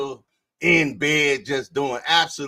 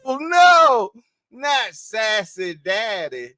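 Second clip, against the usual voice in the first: high, loud, drawn-out mock dismay; mock horror; 8 semitones higher, 7 dB louder, slower than usual. Streamer reacting to a viewer's message, mock horrified. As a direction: cry out in exaggerated disbelief.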